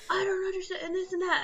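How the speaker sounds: high, mocking complaint; mocking whiny customer. Mocking the customer complaining to the clerk. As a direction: parody the entitled customer whining.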